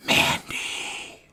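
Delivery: the name whispered, as in her entrance music; whispered like her theme song. Retold or imitated. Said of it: "that's how her, her theme song".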